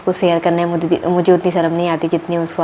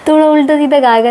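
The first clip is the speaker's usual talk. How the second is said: bursts out higher and louder through laughter; laughing; 6 semitones higher, 4 dB louder than usual. Real, not acted. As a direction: restart the line still laughing. Restarts laughing at husband's singing. (हँसते हुए ज़ोर से)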